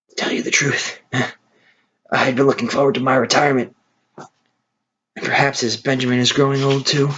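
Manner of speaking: tired, resigned farewell, held back; old horse Boxer. Acted.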